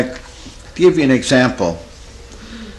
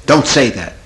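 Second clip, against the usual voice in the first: louder, firm retold rebuke; mock scolding; 2 semitones higher, 5 dB louder than usual. Therapist testing a client who repeats her line, mock scolding. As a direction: act out a stern telling-off.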